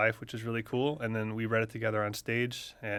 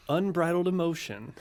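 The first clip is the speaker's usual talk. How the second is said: newscaster voice; 6 semitones higher than usual. Retold or imitated. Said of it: "the description that the local news".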